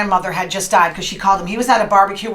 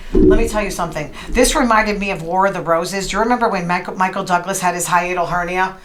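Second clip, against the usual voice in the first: ordinary talk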